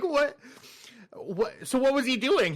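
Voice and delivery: high, mock-incredulous questions; baffled questioning. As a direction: act out total bafflement. Baffled questioning in retold scene.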